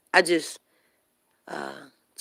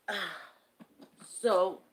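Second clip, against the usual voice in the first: ordinary talk, nothing marked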